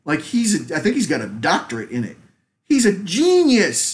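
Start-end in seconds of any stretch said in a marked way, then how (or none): 2.6-3.9 mock awed. Dolph Lundgren praised as genius.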